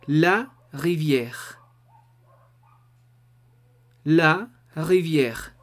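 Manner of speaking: "La rivière" slow, with a throaty R; French R. Shown as a demonstration. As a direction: stretch "La rivière" and roll the R in the throat. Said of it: "La tercera palabra va a ser rivière".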